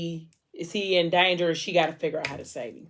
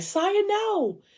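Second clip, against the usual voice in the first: mock scream. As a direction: cry out high, holding back the volume. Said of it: "wakes up and screams".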